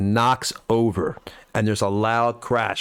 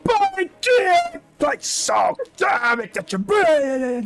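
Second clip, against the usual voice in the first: high, slow garbled yelling; mumbled yells; much slower than usual. Acted. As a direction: fume incoherently at the chaos. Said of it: "mumbled yells and grunts".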